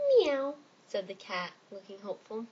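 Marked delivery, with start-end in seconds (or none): 0.1-0.5 cat meow. Acted. Cat voiced in story.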